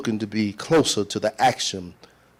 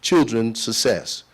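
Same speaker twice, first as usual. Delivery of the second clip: normal talking voice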